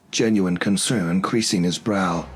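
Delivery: usual speaking voice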